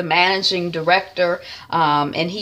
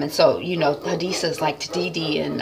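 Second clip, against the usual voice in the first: usual speaking voice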